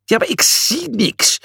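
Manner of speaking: high-pitched wail; whining. A narrator's reading. Julius with soapy water in his eyes, whining. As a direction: whine it in a high voice. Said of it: "jankte Julius".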